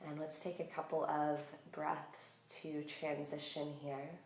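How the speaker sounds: calm, unhurried meditation voice with long pauses; guided meditation. Real, not acted. Yoga teacher settling listeners into breath awareness, calm guided meditation.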